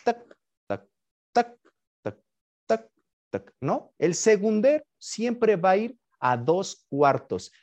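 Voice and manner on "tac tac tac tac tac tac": two-beat ticking. Shown as a demonstration. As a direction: imitate a clock in two-four time, evenly spaced. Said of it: "nuestro reloj es de dos cuartos". (imitando el reloj a dos cuartos)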